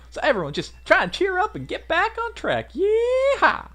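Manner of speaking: very high, whooping Southern cheer; Southern pep talk rising to a loud yeehaw; Southern girl. Acted. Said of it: "why I made her Southern".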